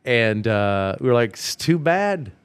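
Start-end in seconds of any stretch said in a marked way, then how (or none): 1.3-2.3 dismissive retort. Acted. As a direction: brush off jealous teammates with playful scorn. Mocking texted retort to jealous teammates.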